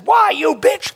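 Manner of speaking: high, loud indignant retort; mock outraged shout. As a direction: hurl it back, outraged.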